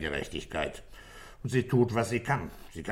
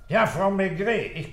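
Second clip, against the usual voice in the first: calling on the phone; 11 semitones higher, 6 dB louder than usual. Acted. Calls his wife on the phone.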